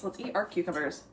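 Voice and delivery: ordinary talk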